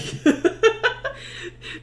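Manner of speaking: high, breath-only giggle; laughing. Real, not acted. Amused at own grim tale, helpless laughing. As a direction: dissolve into airy laughter.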